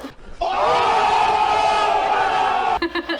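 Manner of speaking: big burst of laughter; loud laugh. Real, not acted.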